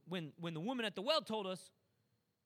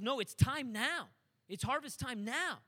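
slow, weighted and firm; slow emphatic preaching; 3 semitones higher, 4 dB louder, slower than usual. Acted. Preacher voicing Jesus insisting to disciples, slow and emphatic. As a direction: lay down each word deliberately.

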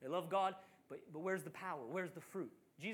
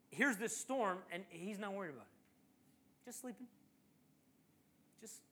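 normal talking voice

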